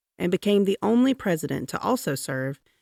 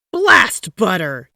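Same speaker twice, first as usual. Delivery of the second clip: loud slow curse; 3 semitones higher, 10 dB louder, slower than usual. A narrator's reading. Taft cursing the plan.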